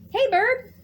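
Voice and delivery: high, loud friendly raccoon; chirpy raccoon. Acted. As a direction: hail Bird cheerfully as Raccoon. Raccoon greets Bird.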